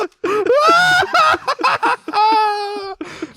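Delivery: high, loud witchy cackle; witch laugh. Real, not acted. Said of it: "Oh, that's my witch laugh".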